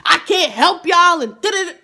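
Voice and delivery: high and loud, slowed-down outburst; loud driver. Retold or imitated. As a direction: snap it back at the whole bus. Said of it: "So she starts getting loud".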